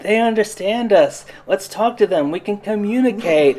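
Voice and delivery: high, earnest hippie tone; naive hopeful voice. Retold or imitated. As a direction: lightly play a trusting dreamer. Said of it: "when he's like".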